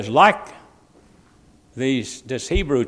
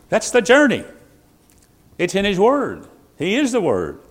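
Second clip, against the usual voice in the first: higher and louder preaching; full-force sermon climax; 1 fervent preaching; 8 semitones higher, 6 dB louder than usual. Real, not acted. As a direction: preach it with rising fire.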